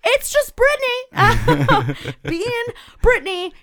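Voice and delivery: high, slow, mocking guy voice; drawn-out dismissive brush-off; mocking dismissive boyfriend. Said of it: "and he went, like".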